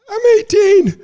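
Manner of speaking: delighted high-pitched laughing reply; giddy excited squeak. Retold or imitated. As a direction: voice jumps way up, laughing. Excited kid quoted, laughing.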